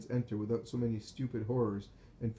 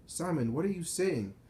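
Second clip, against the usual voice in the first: raised pitch, urgent questioning; alarmed question; 7 semitones higher than usual. A narrator's reading. Joseph to the man striking his head, alarmed. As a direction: demand an answer, voice pitched high. Calling a raving man by name.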